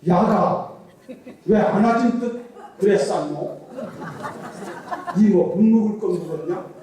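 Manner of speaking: loud, blunt Gyeongsang dialect with a laugh; Gyeongsang accent. Retold or imitated. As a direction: bark it out like a gruff Gyeongsang husband, laughing. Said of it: "경상도 총각이 뭐라고 하냐면".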